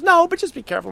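high insistent warning; lightly voiced roommate pressing the point; high, firm be careful; roommate's warning. Mara voiced in retold story.

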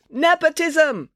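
high, drawn-out heckle; outraged cry. A narrator's reading. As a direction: cry it slowly from the crowd. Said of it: "cried a voice from the crowd".

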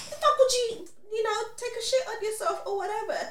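high, loud exasperated scolding; mad friend. Retold or imitated. Friend scolds her after the accident, high exasperated yelling. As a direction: tear into her, half laughing. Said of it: "She's like".

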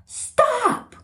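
high urgent yell of stop; hollering. Retold or imitated. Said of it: "he's out there hollering at Kyler".

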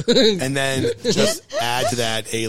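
ordinary talk, nothing marked

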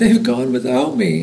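high-pitched storybook lament; mild read-aloud wail; plaintive cry. A narrator's reading. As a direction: cry it out, lightly acted. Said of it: "he cried".

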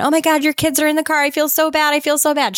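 put-on high, fast panicked girl; imitating a panicked apology; mock freaking out. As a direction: gush in a panic, high and quick. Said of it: "she starts freaking out again".

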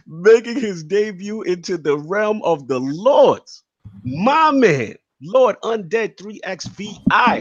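high, slow and drawn out like a ring announcer; hype announcer intro. Acted. Host hypes the debut guest like a ring announcer, high and slow. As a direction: build the crowd for the podcast's debut guest.

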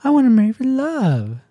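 high, dreamy princess voice; high girly voice. Retold or imitated. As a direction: swoon like a princess. Quoting the princess.

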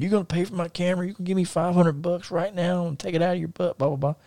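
high, held-back mock screaming. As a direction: rattle off the retold rant high and soft. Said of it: "I'm screaming".

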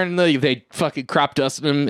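ordinary talk, nothing marked

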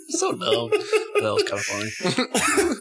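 giggling through the words; slow words through laughter; laughing. Real, not acted. Laughing at a joke.

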